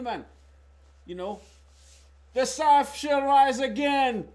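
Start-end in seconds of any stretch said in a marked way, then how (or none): 2.3-4.3 slow, loud, higher attempt at a Scottish accent; hammed-up Scottish accent with a laugh in it; a streamer trying out a Scottish accent for chat, slow and loud; mock Scottish accent. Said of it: "I can get my Scottish accent together".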